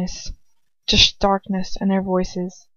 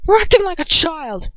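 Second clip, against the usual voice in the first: a touch louder, scolding; raised voice; 8 semitones higher, 4 dB louder than usual. A narrator's reading. Lucius scolds Bellatrix with his voice raised. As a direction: tell her off sharply. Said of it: "Lucy's voice raised".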